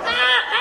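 high, loud squawking cries; imitated screech. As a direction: squawk out two comic shrieks. Imitates screeches in the bird chase.

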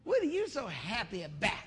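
neck-rolling indignation with exaggerated swoops; hammed-up sassy disbelief; mock sassy challenge. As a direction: imitate an offended coworker, laying it on thick. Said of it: "They gotta do that neck".

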